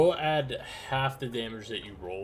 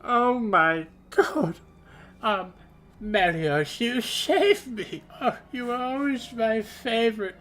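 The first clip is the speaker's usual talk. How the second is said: very high, slow, loud gushing voice; gushing Delg; 12 semitones higher, 7 dB louder, slower than usual. Acted. Delg bursting in to thank Melios, very high and gushing. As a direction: swoon over your rescuer. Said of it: "she goes".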